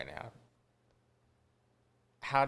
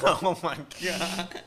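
laughing; 6 semitones higher, 4 dB louder, slower than usual. Real, not acted. Laughing at the letter.

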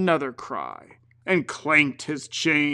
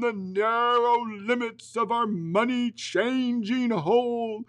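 high, slow mournful ghost; a restless ghost lamenting; 7 semitones higher, slower than usual. Acted.

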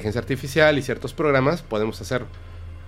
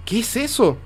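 astonished astronaut; 3 semitones higher than usual. Retold or imitated. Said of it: "le dice a Neil Armstrong".

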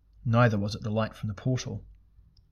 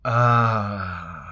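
loud, long, falling "aah"; great booming "ah"; 7 dB louder than usual. A narrator's reading. Burning god's voice fills the tunnel, great loud cry. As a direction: let a huge, drawn-out cry fill the space. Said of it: "A great voice suddenly filled the tunnel".

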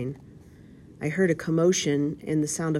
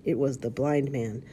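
usual speaking voice

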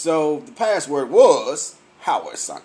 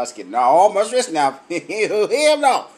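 ordinary talk, nothing marked

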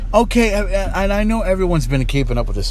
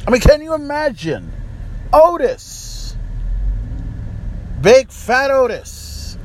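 ranting shout; 10 semitones higher than usual. Real, not acted. Ranting, mocking Otis as champion.

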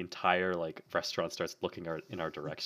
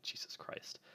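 whispered retold exclamation; hushed, private oath; under-the-breath whisper; 13 dB quieter, slower than usual. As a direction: whisper it to yourself, uneasily. Muttering to himself as stranger stares.

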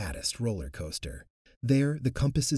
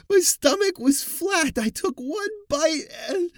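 much higher, loud, slow sobbing plea; fevered crying; 8 dB louder than usual. Acted. Bloating woman telling the doctor what happened, hysterical crying. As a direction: explain in hysterical tears. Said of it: "Her fevered explanation".

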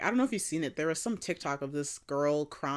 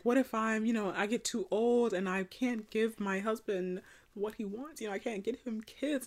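soft, higher-pitched retold worry; quiet, raised voice playing at weepy; mock crying; 3 semitones higher, 6 dB quieter than usual. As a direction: imitate her tearful fretting, lightly. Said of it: "she was crying".

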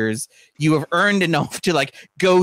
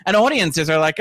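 normal talking voice

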